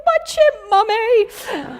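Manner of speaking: high, wailed little-girl imitation; mock tearful squeal to mum; overwhelmed child. Daughter telling mother who is in control, mock overwhelmed wail. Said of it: "she's still overwhelmed from emotions".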